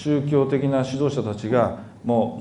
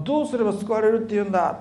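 mock shout; 7 semitones higher than usual. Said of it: "と大きな声で誰かが叫びました".

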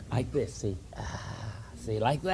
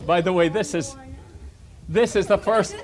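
usual speaking voice

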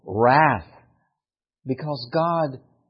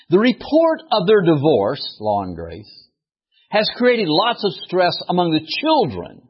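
emphatic, higher-pitched sermon reading; reading aloud; 5 semitones higher, 6 dB louder than usual. Acted. Preacher reading his allegory to the congregation, declamatory read-aloud. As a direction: declaim the written piece with punched key words.

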